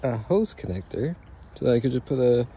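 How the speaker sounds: talking through hiccups; words jolted by hiccups. Real, not acted. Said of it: "I have hiccups".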